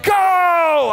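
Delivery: high, loud yell; full shout. Retold or imitated. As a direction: bellow it out. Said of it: "when he yelled".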